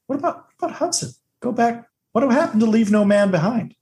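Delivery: very high, squeaky protest; mock plea. Viewer begging the heroes to go back, mock-pleading. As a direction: plead in a thin, high pitch.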